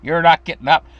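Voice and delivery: mother ignoring her crying son's tears; firm mother. Retold or imitated. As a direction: refuse to let him leave. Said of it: "She'd say".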